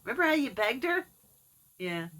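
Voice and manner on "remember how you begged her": higher, louder teasing; teasing her dad about begging. Retold or imitated. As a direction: needle him playfully. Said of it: "I'm like".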